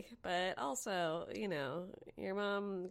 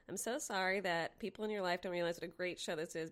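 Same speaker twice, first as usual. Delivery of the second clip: normal talking voice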